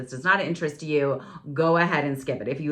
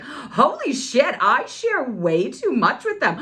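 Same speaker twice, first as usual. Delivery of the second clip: mock shock; 9 semitones higher, 5 dB louder, slower than usual. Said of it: "I listened to this and I was like".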